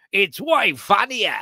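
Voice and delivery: exaggerated Aussie accent. Shown as a demonstration. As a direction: ham up the Australian vowels. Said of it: "when I do it like this".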